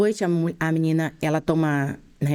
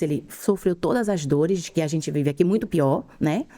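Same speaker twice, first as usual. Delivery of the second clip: ordinary delivery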